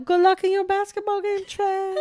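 very high, teasing send-off; squeaky mock well-wishing; high sing-song call. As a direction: call it back in a silly high voice. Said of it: "And I'll go".

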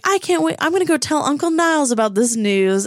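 high, gloating fake cheer; mock excitement. Freddy gloating to his parents, high mock excitement. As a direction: rub it in with bright false glee. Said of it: "he's like".

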